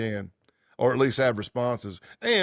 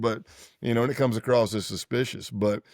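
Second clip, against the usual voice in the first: ordinary delivery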